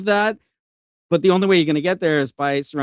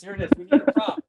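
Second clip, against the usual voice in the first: turned away from mic; 4 semitones higher, 6 dB quieter, much faster than usual. Real, not acted. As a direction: voice drifting off mic. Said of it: "You're off mic, man".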